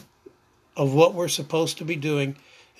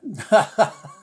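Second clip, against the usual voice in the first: real laugh; 4 semitones higher, 5 dB louder than usual. Real, not acted. Laughing at his own story.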